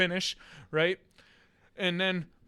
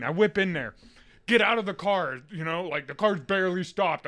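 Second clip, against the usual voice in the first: usual speaking voice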